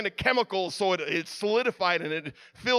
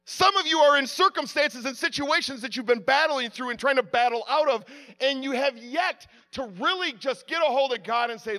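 usual speaking voice

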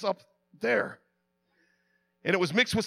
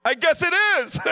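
higher and louder, half-laughing; laughing reply; 8 semitones higher, 6 dB louder, slower than usual. Retold or imitated. Soaked speaker answering Frenchie's joke, laughing along. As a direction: answer through rising laughter, giving in to the joke. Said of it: "And I go".

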